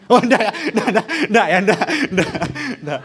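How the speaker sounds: giggling no, no, no; a kid laughing while refusing; laughing refusal. Acted.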